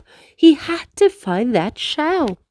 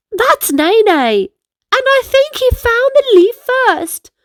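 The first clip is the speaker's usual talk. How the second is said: put-on amused boy; 12 semitones higher, 5 dB louder than usual. Ocean laughing, high.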